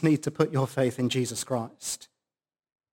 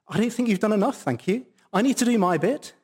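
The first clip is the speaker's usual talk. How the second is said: higher-pitched, prim brush-off; polite mock refusal; 7 semitones higher, faster than usual. Preacher voicing someone telling Jesus no thanks, politely dismissive. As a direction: imitate someone politely turning down help.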